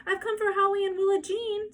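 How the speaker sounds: high, laughing friendly voice; high laughing lady's voice; cheery visiting mother. A narrator's reading. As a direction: greet her brightly with a laugh. Mrs Kemp arriving cheerily.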